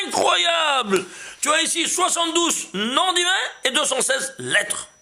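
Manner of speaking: high, loud burst of wonder at the discovery; amazed shout. Real, not acted. Teacher to his students, amazed at the numbers, loud and high.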